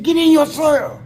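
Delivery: high, loud retold guard's shout; hollered order. Guards answer the cussing inmate, hollering. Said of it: "They was hollering".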